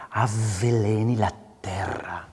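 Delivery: slow whispered avveleni la terra; soft, saving rage. Shown as a demonstration. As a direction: hold back the rage in a hush. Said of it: "Even if it's soft and you're".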